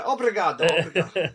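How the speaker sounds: obrigado rolled out in a hammed-up Italian accent, laughing; mock Italian accent. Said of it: "I can only do it in an Italian accent".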